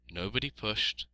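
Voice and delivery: plain reading voice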